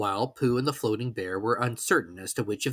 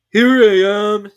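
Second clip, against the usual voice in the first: slow, loud, higher bear voice; growly voice; 10 semitones higher, 13 dB louder, much slower than usual. A narrator's reading. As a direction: Pooh pops up behind him, pleased. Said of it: "said a growly voice behind him".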